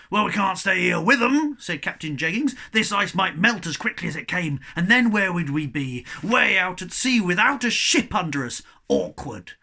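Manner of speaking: loud, firm reply; firm captain. A narrator's reading. Captain firmly telling the cabin boy why they must leave. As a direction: argue the point.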